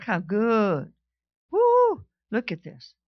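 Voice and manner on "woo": high loud preacher's whoop; joyful whoop. Real, not acted. To the congregation, a joyful whoop. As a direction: let out a happy whoop.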